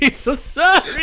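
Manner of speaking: high mock whine; mock crying kid. Mocking the nephew's apology, high whine. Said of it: "mocking the crying kid".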